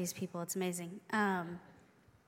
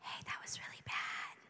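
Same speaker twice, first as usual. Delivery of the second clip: hushed whisper of a conscience; quiet whisper; 8 dB quieter than usual. Shown as a demonstration. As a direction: breathe it like a small voice in your head. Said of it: "the quiet whisper in the back of your head".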